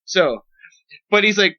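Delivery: usual speaking voice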